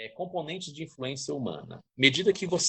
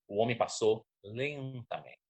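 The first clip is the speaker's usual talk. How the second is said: slow, softer "lentamente" to show slowness; drawn-out "lentamente"; 3 dB quieter, slower than usual. Shown as a demonstration. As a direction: stretch "lentamente" out slowly. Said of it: "Aí você já mudou".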